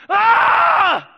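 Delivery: high, very loud wail of grief; anguished cry. Acted. Egyptian family as the firstborn son dies, anguished cry. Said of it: "they hear a cry".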